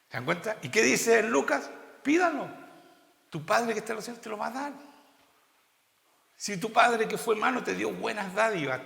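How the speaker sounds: loud, high-pitched sermon build; full-force preaching. Acted. Pastor urging the church to receive the Spirit, loud preaching. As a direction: preach it with rising fervour.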